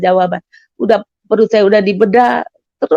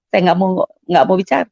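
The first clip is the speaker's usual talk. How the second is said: usual speaking voice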